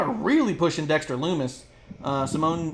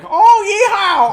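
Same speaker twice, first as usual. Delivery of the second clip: yokel yeehaw; 13 dB louder, much slower than usual. Retold or imitated. Cameron Grimes mocked as a cartoon yokel, loud yeehaw. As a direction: whoop it like a cartoon country yokel. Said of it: "the yokel from Simpsons".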